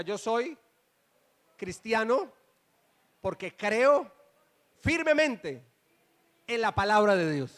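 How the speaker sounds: frases cortadas por pausas para que repitan, cada vez más firmes; declaración dirigida. Real, not acted. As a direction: dicta la confesión trozo a trozo, subiendo la fuerza. Dicta una declaración a la congregación. (declaración de fe para repetir en coro)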